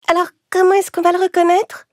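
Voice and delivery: put-on very high childlike voice; little goat kid. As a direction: ask like a small worried kid. (voix aiguë de petit chevreau)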